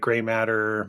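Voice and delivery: usual speaking voice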